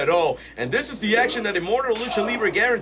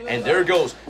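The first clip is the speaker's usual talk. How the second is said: normal talking voice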